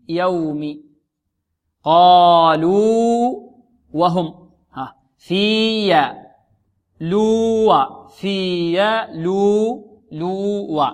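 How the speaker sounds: يوم and قالوا drawn out, the two letters kept apart; slow, stretched في يا and لو; slow letter demo. Shown as a demonstration. Teacher breaks the example words down slowly. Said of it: "فقال في".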